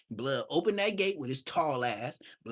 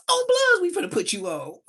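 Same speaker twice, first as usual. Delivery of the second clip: very high, loud excited shout; hyped yell; 6 dB louder than usual. Retold or imitated. Smiley hyping up the young teller, high excited shout. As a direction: yell it out, thrilled to put him in.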